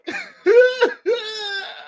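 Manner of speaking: high burst of laughter, half crying. Real, not acted. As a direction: break into a high, helpless laugh. Laughing at own story.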